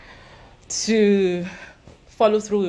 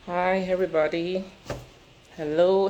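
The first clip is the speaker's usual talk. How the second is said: ordinary talk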